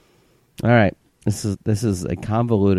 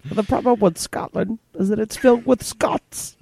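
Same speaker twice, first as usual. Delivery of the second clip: put-on high, rasping old king; 13 semitones higher than usual. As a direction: croak it like a dying English king. Quoting Braveheart king.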